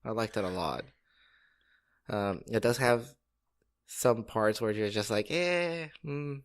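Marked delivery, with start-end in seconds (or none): 5.3-6.4 higher-pitched noncommittal "eh"; hesitant, unconvinced hum; doubtful reaction. Retold or imitated. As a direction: voice mild doubt about it.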